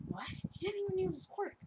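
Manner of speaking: high-pitched incredulous exclamation; hammed-up disbelief; mock astonishment. Everybody's retold astonished reaction.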